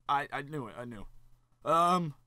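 ordinary delivery

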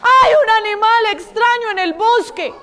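high-pitched scared chick raising the alarm; frightened chick. Acted. Chick warning mother hen about a strange animal, frightened. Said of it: "asustado ese pobre pollo".

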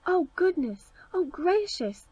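lightly voiced dwarfs marvelling aloud; astonished cry. A narrator's reading. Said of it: "they cried".

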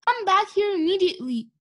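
high, insistent command; shouted order. A narrator's reading. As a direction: call her back at once. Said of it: "shouted Eugenia".

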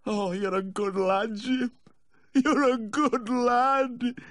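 very high, slow wail; weepy drawn-out high-pitched thanks; tearful gratitude. A narrator's reading. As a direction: wail your thanks, stretching the last words. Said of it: "The voice trailed away".

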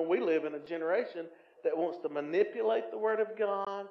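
ordinary talk, nothing marked

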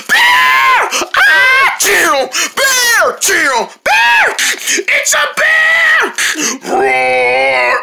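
put-on children shrieking as a bear charges; panicked screaming. Turtles flee a charging bear.

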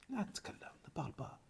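whispered, barely voiced; hushed advice. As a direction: lean in and hush it. Prêcheur chuchote le conseil. (conseil chuchoté)

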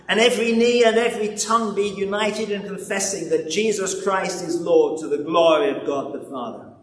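usual speaking voice

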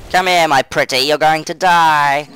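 hammed-up villain, last line slow; mock villain taunt. Gamer taunting the spiders, hammed-up villain taunt. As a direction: lure the spiders in like a cartoon baddie.

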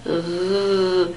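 drawn-out R from the back of the mouth; soft, sustained throaty R; German R sound. Shown as a demonstration. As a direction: hold a uvular R, narrowing the back of the tongue. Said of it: "You just have to make this channel between".

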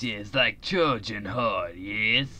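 slow, low mock Russian accent; lightly put-on Russian accent; a hint of a Russian accent. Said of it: "made an alien sound like a Russian".

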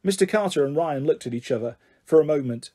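usual speaking voice

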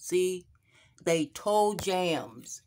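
ordinary talk

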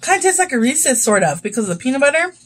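normal talking voice

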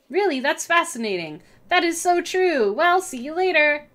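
lightly acted party small talk; rehearsed small talk. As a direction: practise fake interest. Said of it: "We'll go with".

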